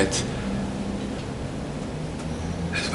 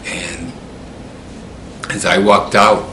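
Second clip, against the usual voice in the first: plain reading voice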